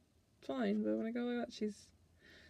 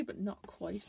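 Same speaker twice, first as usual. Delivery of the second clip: usual speaking voice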